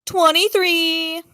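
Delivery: high, loud, drawn-out cheer; real excited call-out; triumphant shout. Real, not acted. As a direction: crow the winning number, stretching it out. Calls out a high dice roll.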